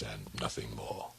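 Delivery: breathy whispered ending. Acted. Alone at night, he whispers to calm himself. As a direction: let it fade to a whisper. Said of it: "I stood repeating".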